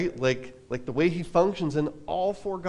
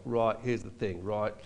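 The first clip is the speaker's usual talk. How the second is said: a lower, softer mock-Australian "right"; band's Australian impression; 6 semitones lower, 6 dB quieter than usual. Bandmates mocking their road manager, mild Australian impression. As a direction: tease him with a light Australian accent. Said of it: "when we would do impressions of him".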